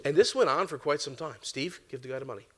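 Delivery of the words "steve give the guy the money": still small voice. Retold or imitated. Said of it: "still small voice".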